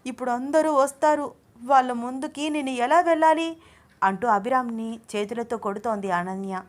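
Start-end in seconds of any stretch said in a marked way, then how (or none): 0.0-3.6 high-pitched indignant telling-off; angry scolding. A narrator's reading. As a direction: scold him in a raised, outraged voice. Bitten woman scolds and hits husband. (కోపంగా పెద్ద గొంతుతో తిడుతూ)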